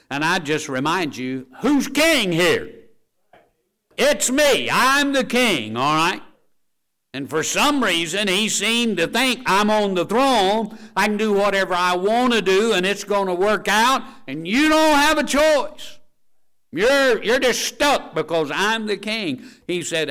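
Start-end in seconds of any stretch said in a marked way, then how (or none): 0.1-6.2 much higher, louder, slow mocking king; arrogant king. Preacher mocking King Rehoboam's boast to his people, high and loud. 9.5-19.4 arrogant king. Acted. Preacher mocking King Rehoboam's boast to his people, high and loud. Preacher voicing king's thoughts.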